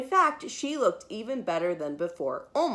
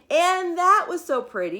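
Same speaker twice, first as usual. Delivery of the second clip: high, loud sing-song gush; delighted refrain; 9 semitones higher, 6 dB louder than usual. A narrator's reading. Narrator gushing to young listeners, high and delighted. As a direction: gush over how pretty it is.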